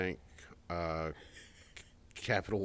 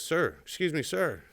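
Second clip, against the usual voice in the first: higher, polite call for attention; pleading stranger; 8 semitones higher, 3 dB louder, slower than usual. Retold or imitated. A drunk stranger at the pump calls to him, politely higher. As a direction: raise the voice and ask politely. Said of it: "And he goes".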